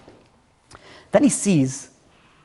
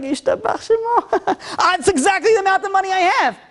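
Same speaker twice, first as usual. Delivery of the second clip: much higher mock voice of a delighted seller; gleeful seller; 13 semitones higher than usual. Seller gushing to the buyer in a much higher voice. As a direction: squeal it out like a seller who can't believe his luck.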